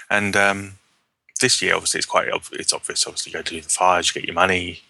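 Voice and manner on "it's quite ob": usual speaking voice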